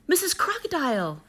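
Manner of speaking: a high, eager call from the kangaroo mother; Katie calling out. Acted. Kangaroo mother calling hopefully to the crocodile, eager high call. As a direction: call to her brightly, with sudden hope.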